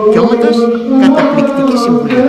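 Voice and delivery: excited praise. Real, not acted. Enthusiastic praise of the advice.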